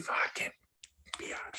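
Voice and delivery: breathy hushed swearing under the breath; whispered curse. Real, not acted. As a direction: whisper it so nobody hears. Cursing at the hot challenge.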